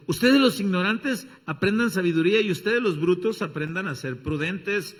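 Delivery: loud, ringing call to the foolish; a preacher's loud reading of the verse; preached scripture. Acted. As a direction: proclaim it, slowing on the last words.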